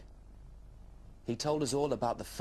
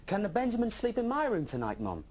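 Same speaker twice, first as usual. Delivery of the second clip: put-on high, louder eager child asking; young dragon pleading to keep the Benjamin; 10 semitones higher, 4 dB louder than usual. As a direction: beg mum sweetly for a yes. Little dragon asks mum.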